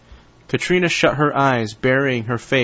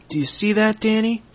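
put-on a touch of a frightened girl; 10 semitones higher than usual. Child character voiced in story.

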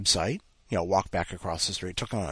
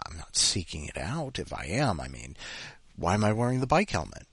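normal talking voice

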